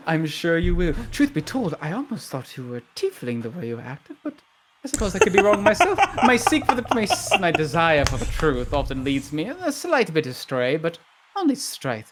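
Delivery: animated newswoman, pitch swooping about; chatty truth-seeking reporter. Acted. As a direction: ramble playfully with a light laugh. Plays Mary Joy Revelry, chatty.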